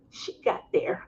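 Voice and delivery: whispered aside; hushed she got there. Real, not acted. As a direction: breathe it out under your breath.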